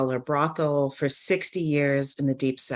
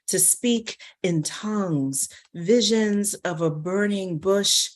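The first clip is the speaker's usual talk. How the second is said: raised, swelling preacher's cadence, higher; rapturous sermon; 4 semitones higher than usual. Acted. The madam telling the child what men want, rising preacher fervour. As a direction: build it like a revival sermon.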